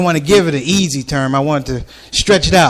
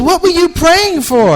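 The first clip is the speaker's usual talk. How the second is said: much higher, slow wary lady voice; nervous lady; 11 semitones higher than usual. Retold or imitated. Lady asking about his prayer, high wary voice. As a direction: ask warily.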